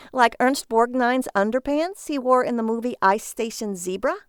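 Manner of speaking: a sarcastic jab at his junk; mocking wife.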